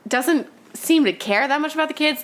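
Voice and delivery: normal talking voice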